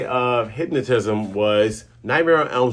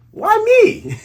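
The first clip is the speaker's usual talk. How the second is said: high, louder squeal of disbelief; squealing protest; 5 dB louder than usual. Retold or imitated. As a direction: shriek it like a scared girl. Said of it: "Nami's like".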